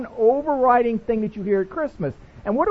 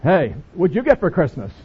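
lower, gruffer-sounding guy's greeting; man voice; 4 semitones lower, 3 dB louder than usual. Retold or imitated. Mock man voice as the guys ask about gifts. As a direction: put on a manly voice. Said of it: "I'm trying to do the man voice now".

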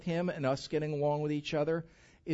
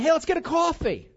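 mock friendly invite; 12 semitones higher, 6 dB louder than usual. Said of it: "They'd say to me".

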